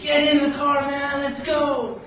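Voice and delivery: high, loud, drawn-out holler; acted shout to hurry someone along; urgent calling out. Passing drivers to man in flood, urgent high call.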